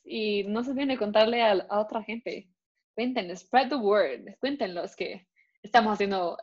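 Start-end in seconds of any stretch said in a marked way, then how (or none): none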